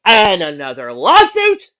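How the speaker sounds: slow, loud, high teasing jab; slow teasing question. Acted. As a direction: drag out the jab knowingly. Wry jab at grandpa.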